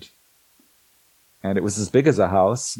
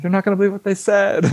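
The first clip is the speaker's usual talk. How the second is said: higher, fast and eager; excited retelling; 10 semitones higher, faster than usual. Retold or imitated. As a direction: rush it out with excitement. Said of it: "I'm like".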